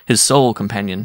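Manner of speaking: ordinary delivery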